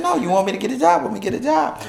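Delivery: high, fast, excited dare; excited insistence. Retold or imitated. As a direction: push it up high and fast, playful. Insisting to Donna at Kohl's.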